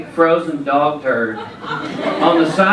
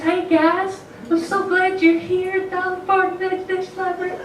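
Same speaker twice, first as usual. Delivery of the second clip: Southern mom; 3 dB quieter than usual. Retold or imitated. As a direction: imitate a precious Southern mother in a tiny high-pitched voice. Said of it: "She still talks like this".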